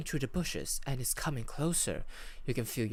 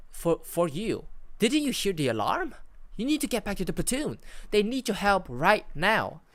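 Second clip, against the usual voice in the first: higher, louder, urgent radio voice; acted startled alarm, pitched up; 6 semitones higher, 4 dB louder than usual. A narrator's reading. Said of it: "is clearly startled".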